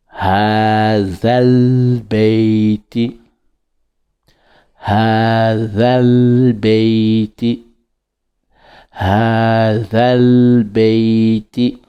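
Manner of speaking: "ha zal beiti" slow, za bound to al; linked recitation. Shown as a demonstration. Teacher recomposing the phrase slowly, linked. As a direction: join "zal" smoothly and slowly. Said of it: "si on veut recomposer".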